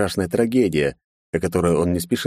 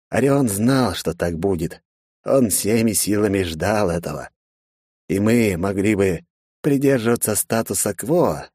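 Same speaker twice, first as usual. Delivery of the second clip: ordinary delivery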